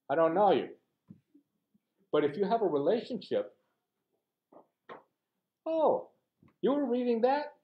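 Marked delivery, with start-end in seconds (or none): 5.6-7.5 high, bright-eyed oh of recognition; sudden lifted pitch, mock-surprised friend; pleasantly surprised. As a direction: react like a friend who just noticed. Friend recalls reading scripture.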